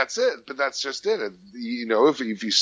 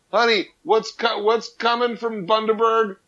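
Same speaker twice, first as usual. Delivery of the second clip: wife's voice; 9 semitones higher than usual. Retold or imitated. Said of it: "my wife tells me".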